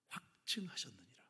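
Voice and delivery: soft, slow, unvoiced word of scripture; hushed verse read to the flock; whispered scripture. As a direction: breathe the verse like a secret.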